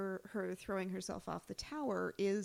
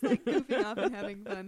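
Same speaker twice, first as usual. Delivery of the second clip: laughing; 7 dB louder than usual. Real, not acted. Laughing about cast outtakes.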